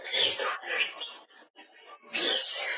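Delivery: slow unvoiced whispered begging; hushed plea to a captor. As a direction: beg in a terrified whisper. Frightened pleading victim.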